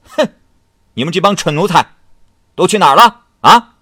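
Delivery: put-on high, loud drunken tirade at servants; furious scolding. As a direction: bellow at the useless servants. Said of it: "大骂".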